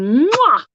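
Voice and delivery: big, high, loud "mwah"; imitated kiss. Medium acting out the spirit's kiss, big mwah. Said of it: "he's just going".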